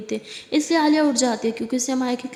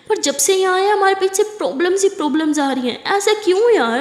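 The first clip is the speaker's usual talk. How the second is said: higher and louder, exasperated; frustrated outburst; 5 semitones higher, 3 dB louder than usual. Acted. Girlfriend venting at endless problems, higher and louder. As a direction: throw up your hands at the problems.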